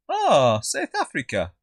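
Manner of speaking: loud drawn "Oh", then easing off; mock realisation. Restaurant staff guessing the mate's accent, mock realisation. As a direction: imitate someone thinking they've cracked it.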